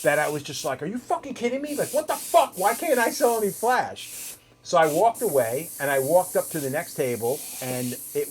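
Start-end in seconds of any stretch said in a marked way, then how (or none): 0.8-4.4 high-pitched exasperated rant; fucking mad. Retold or imitated. Tattooist retelling his envy over friend's flash sales, fuming. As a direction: replay the outrage, pitch jumping high. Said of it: "I was so fucking mad".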